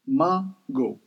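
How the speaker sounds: "Mago" split into mah, go; syllable-by-syllable Creole. Shown as a demonstration. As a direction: teach "Mago" in two separate syllables. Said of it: "mango in Creole is mago".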